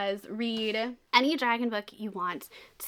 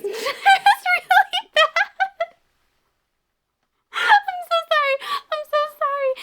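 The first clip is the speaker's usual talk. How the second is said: real giggling fit, very high squeaky voice gradually settling; helpless laughter; 10 dB louder than usual. Real, not acted. Reviewer cracking up at the bad audiobook, squealing with laughter. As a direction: talk through uncontrollable laughter, loud and high, then recover. Cracks up after her dragon imitation.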